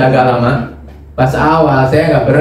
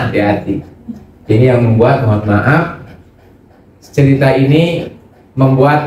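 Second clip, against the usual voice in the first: ordinary talk, nothing marked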